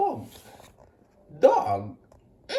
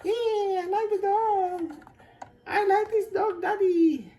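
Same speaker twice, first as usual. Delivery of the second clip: voz de criança; 11 semitones higher than usual. Acted. Child George delighted with dog.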